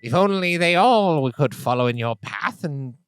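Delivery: put-on a polite old scholar musing over tea; elder gnome voice. GM voicing the elder gnome to the mind flayer, pleasant and chatty.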